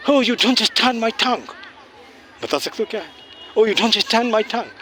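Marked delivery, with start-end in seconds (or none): 0.1-1.5 high, slow imitation of an English accent; English foreigner's accent. English customer to little shop girl who stays silent, high imitated English accent. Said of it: "एक अंग्रेज आई किसी दुकान में". 3.6-4.7 high, slow imitation of an English accent; English foreigner's accent. English customer to little shop girl who stays silent, high imitated English accent. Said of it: "एक अंग्रेज आई किसी दुकान में".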